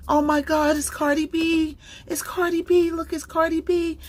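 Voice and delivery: high, thrilled star-struck gasp; excited fan squeal. Retold or imitated. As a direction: imitate a fan spotting a celebrity. Guard spots [name] on phone.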